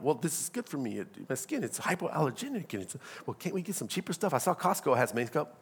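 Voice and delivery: a hint of a sheepish husband, quiet and low; meek husband. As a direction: mumble a weak defence to his wife. Husband voiced in couple's argument.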